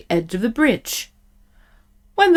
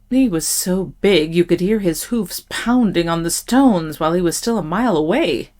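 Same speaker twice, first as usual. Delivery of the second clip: ordinary delivery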